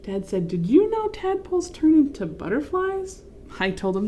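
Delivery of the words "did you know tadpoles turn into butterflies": teasing dad voice. Acted. Dad teasing children in story.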